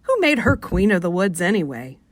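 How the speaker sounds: plain reading voice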